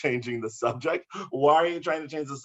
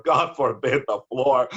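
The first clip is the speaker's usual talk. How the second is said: usual speaking voice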